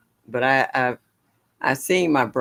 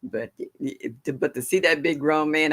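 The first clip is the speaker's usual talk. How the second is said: ordinary delivery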